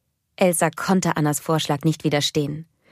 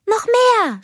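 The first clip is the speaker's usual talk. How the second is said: put-on high, loud, thrilled child; excited cry; 5 dB louder than usual. A narrator's reading. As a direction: cry out for more. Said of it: "rief Anna". (hoher, begeisterter Ausruf)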